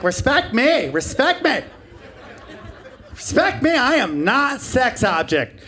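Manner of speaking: high, swooping voice of protest; exaggerated high-pitched demand; mock indignant feminist. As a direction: caricature a feminist demanding respect.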